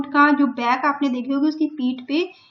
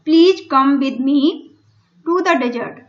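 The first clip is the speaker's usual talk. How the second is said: very slow, louder, every word spaced out; slow dictation; 5 dB louder, much slower than usual. Shown as a demonstration. Teacher reading the story line to Hindi-speaking pupils, very slow and loud. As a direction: read it word by word for the class. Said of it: "teacher reads camel's plea slowly".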